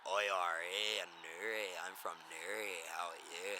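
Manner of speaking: a quick Newry Northern Irish lilt; Newry accent. Shown as a demonstration. To the interviewer, showing a Newry Northern Irish accent. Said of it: "you've got, like".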